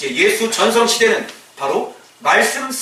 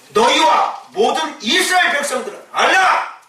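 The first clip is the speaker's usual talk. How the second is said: higher, commanding call; bold proclamation; 7 semitones higher than usual. Retold or imitated. Preacher voices Peter proclaiming to Israel, commanding. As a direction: announce it to all Israel. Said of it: "이렇게 얘기하는 거지".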